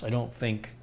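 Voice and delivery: ordinary talk, nothing marked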